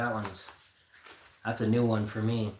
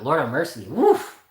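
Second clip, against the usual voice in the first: usual speaking voice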